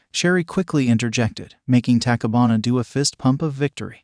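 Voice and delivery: ordinary delivery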